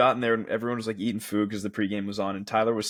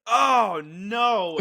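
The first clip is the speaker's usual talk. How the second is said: loud, high drawn-out groan of dread; retold collective dismay, pitched up; group dismay; 9 semitones higher, 4 dB louder than usual. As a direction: cry it out in mock horror. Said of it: "everyone in the room was like".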